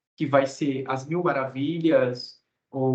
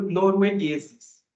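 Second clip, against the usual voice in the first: normal talking voice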